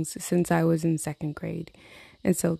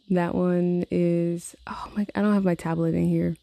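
whisper. Real, not acted.

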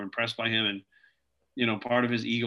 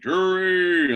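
imitating a big friend's greeting, higher and louder; Rich's way of saying a name; 7 semitones higher, 7 dB louder than usual. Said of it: "he had this way of, like, saying your name".

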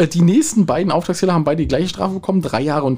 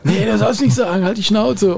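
mild mock shout, pitched higher; half-yelled impression of someone cutting in; imitated yell; 6 semitones higher, slower than usual. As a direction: mimic an angry background shout at raised pitch. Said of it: "schrie der andere schon".

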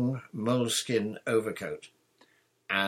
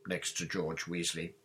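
ordinary delivery, nothing marked

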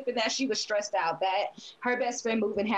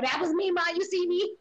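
high-pitched, eager pleading to be noticed; lightly voiced excited youngster; excited girl bragging; 7 semitones higher than usual. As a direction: play a kid proudly telling Mama. Voices girl excitedly telling her mama.

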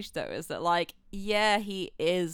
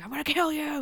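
higher, put-on snake voice yelling; snake yelling back; 8 semitones higher than usual. The pet snake yelling back at Cage, higher put-on voice. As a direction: play the snake snapping back at him, lightly. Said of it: "and the snake yelled back".

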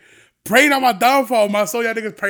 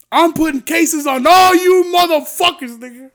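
higher, louder, slow hammed-up threat; mock threat; 9 semitones higher, 7 dB louder, slower than usual. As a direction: drag it out loud and high like a fake warning.

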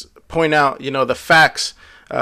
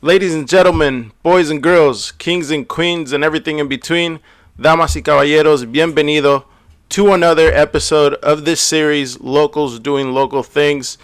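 lucha libre announcer; 5 dB louder than usual. Acted. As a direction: boom it out like a wrestling MC. Host opens show announcer-style.

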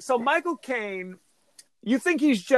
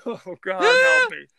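high, strained laughing plea; real helpless laughter; 11 semitones higher, 4 dB louder than usual. Real, not acted. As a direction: gasp it through laughter. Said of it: "laughing helplessly".

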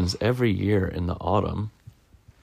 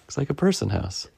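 usual speaking voice

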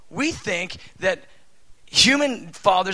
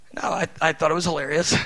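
ordinary talk

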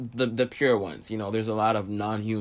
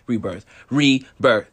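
"rebirth" hammered louder and slower; emphatic repetition; 8 dB louder, slower than usual. Real, not acted. Speaker drills rebirth into listeners, emphatic. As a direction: drive "rebirth" home, drawn out and loud.

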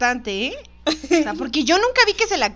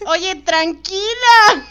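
laughing; 5 semitones higher, 5 dB louder, much slower than usual. Real, not acted. Laughing at fan tweet.

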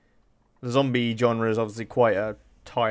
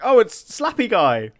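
surprised recognition; 10 semitones higher, 7 dB louder, slower than usual. Retold or imitated. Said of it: "I was like".

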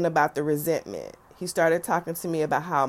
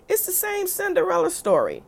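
pitched way up, eager declaration; excited exclaim; 14 semitones higher, 3 dB louder than usual. Real, not acted. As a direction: announce it like a revelation. Punchline about stepmother.

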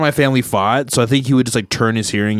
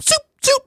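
high mock silencer zoops; cartoonish pew of a silenced gun. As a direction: squeak out a video-game silencer. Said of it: "silencers ... in video games".

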